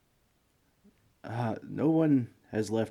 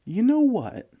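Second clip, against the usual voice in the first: sudden excited idea; 10 semitones higher, 6 dB louder than usual. Acted. Sudden idea, pitching a deal.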